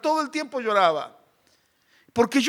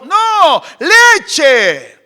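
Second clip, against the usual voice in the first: put-on high, loud toddler protest; imitated tantrum demand; screamed child refusal; 5 semitones higher, 8 dB louder than usual. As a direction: shout down the offer like a stubborn kid. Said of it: "a los gritos desesperados".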